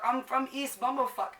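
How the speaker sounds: ordinary delivery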